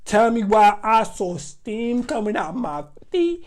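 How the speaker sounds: ordinary talk, nothing marked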